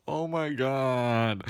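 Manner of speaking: very slow, stretched-out exasperation; long, creeped-out groan of the words; drawn-out dismay. Retold or imitated. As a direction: drag out every word in mock dread. Retold dread at driver's remark.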